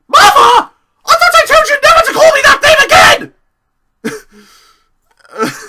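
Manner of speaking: very high, very loud shriek, laughing as it breaks; hammed-up furious yell; screaming mock outrage. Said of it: "It's like".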